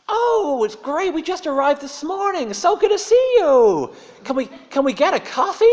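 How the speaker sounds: very high, loud, gushing American voice; booming cheery tourist greeting the waiter. Retold or imitated. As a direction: ham up an overfriendly tourist.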